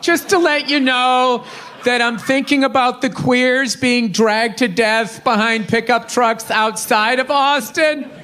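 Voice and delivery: ordinary talk, nothing marked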